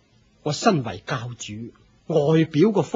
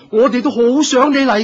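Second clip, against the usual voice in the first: louder, higher and fast, gushing; overly eager; 8 semitones higher, 8 dB louder, faster than usual. Acted. Leader gushing false welcome at the Buddha, excited. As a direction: lay the welcome on thick.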